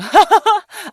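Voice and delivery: loud, high burst of laughter. Acted. Missus Smacklips reacts to Fox's correction, high laugh. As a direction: let out a high-pitched laugh. Ogre laughs before "I see.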